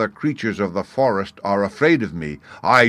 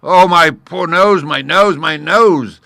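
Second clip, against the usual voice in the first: much louder, much higher pained wail; moaning lion; 10 semitones higher, 11 dB louder than usual. A narrator's reading. Stung lion begging the gnat to come down, wailing. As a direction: beg in pain, over and over. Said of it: "the lion began to moan".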